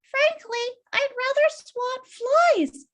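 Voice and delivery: put-on high, slow girlish voice; bored little heroine, high and drawn out; Winnie's voice. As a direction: pitch it way up for Winnie. Winnie voiced in read story.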